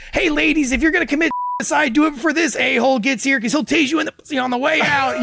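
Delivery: high, loud mock shout to the girls; yelling woman. Woman calling to girls at group home, high loud mock shout. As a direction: call out to the whole hall, high. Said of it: "And she's like".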